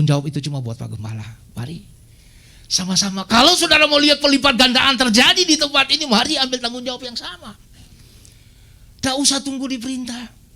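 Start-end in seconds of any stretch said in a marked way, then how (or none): 3.3-10.4 loud, urgent call from the pulpit; rally the church to act; rousing exhortation. Real, not acted. Preacher exhorts congregation. (seruan khotbah yang lantang)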